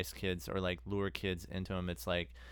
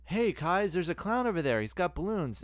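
put-on higher, eager kids pointing; excited kid; 8 semitones higher, 3 dB louder than usual. Kids spotting the clown, mock excited shout. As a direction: call out like a thrilled kid. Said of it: "something like".

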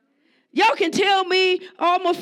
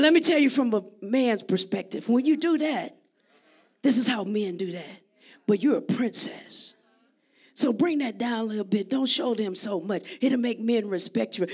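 lower, softer tender advice; quiet loving father talking to daughter; soft fatherly counsel; 6 semitones lower, 5 dB quieter than usual. Retold or imitated. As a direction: gently guide your daughter. Voicing father advising daughter.

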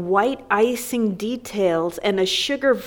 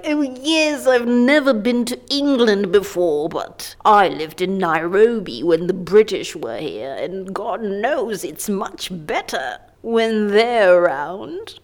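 mock colonial fan voice. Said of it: "people are like".

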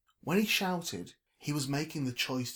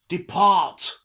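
booming, raised command; very loud pompous order; 7 semitones higher, 15 dB louder than usual. A narrator's reading. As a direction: bellow a grand dismissal. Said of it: "ordered Trevor".

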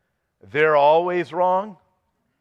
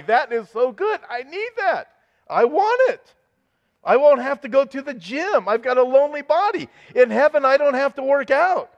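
much higher and louder, gleeful excitement; thrilled; 10 semitones higher, 4 dB louder than usual. Real, not acted. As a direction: burst out giddy at the thought. Excited about a heavenly body.

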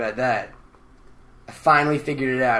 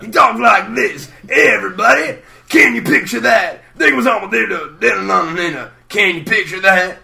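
Doctor Teeth impression; 6 semitones higher, 7 dB louder than usual. Doctor Teeth rallying everybody, loud and high. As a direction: holler like a groovy bandleader. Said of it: "I could talk like Doctor Teeth".

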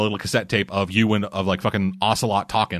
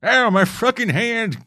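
put-on grumpy old man; 9 semitones higher, 3 dB louder than usual. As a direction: play a crotchety old man yelling at kids. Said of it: "that's him as an old man".

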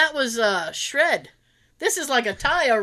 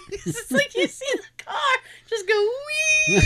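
laughing through the words, pitched higher. Real, not acted. Friend laughing about winter crash videos, giggling high. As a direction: giggle while describing the car.

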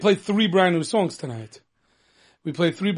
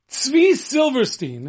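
excited announcement; 6 semitones higher, much slower than usual. Acted. Announcing the artist name.

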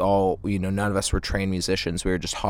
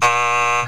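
sudden loud laugh breaking into the answer; a real laugh that cuts off the sentence; burst of laughter; 4 semitones higher, 6 dB louder than usual. Real, not acted. Guest cracking up while describing the drummer, loud laugh.

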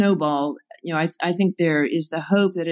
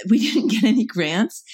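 higher voice broken up by laughter; real laughing; 4 semitones higher than usual. Real, not acted. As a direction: laugh through the words as you admit it. Laughing at their own setback.

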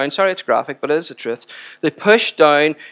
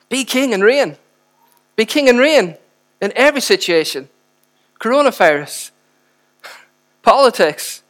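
preaching climax; 5 semitones higher than usual. Real, not acted. Preacher's rising climactic prayer.

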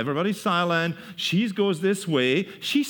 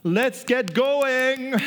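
slow, lightly voiced pleading; drawn-out coaxing, just a hint of impatience; urging disciples; 8 semitones higher, slower than usual. Disciples urging Jesus to eat, slow mild coaxing. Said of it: "his disciples urged him".

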